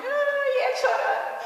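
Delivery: high, loud mock wail; hammed-up mother wailing at a goodbye; mãe chorando. Retells mother wailing at airport goodbye. (choro de mãe exagerado, agudo e alto)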